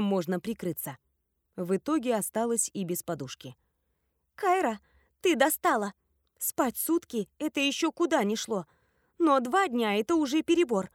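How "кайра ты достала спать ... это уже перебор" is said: high, exasperated scolding; badly out of sorts. A narrator's reading. Nani scolding a friend who slept two days, cross. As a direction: scold the sleeper, fed up. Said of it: "Нани была сильно не в духе".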